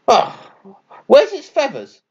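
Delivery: high little chirpy bird voice; chirping blue tit. Acted. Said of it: "a blue tit chirped".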